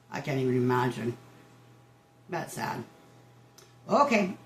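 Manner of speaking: usual speaking voice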